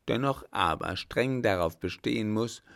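plain reading voice

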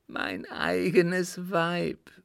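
anguished groan. A narrator's reading. Sick father confessing he killed his wife, groaning. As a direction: groan it slowly in torment. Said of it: "stöhnte der Kranke".

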